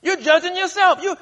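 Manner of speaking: soaring pulpit voice as God; impassioned preaching. Acted. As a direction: press the point home with full force. Preacher voicing God, escalating.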